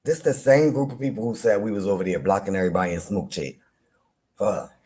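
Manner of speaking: normal talking voice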